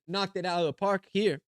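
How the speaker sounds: usual speaking voice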